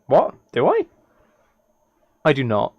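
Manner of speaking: usual speaking voice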